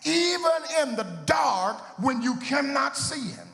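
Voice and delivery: preaching at full force. Acted. Pastor quoting Gardner Taylor to the church, full preaching whoop. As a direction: build the close like a shout to heaven. Preacher builds to sung climax.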